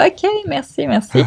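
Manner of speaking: sarcastic "ok, merci", high and soft; mock thanks. Telling how she answered her boyfriend's useless help, sarcastic high thanks. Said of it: "j'étais".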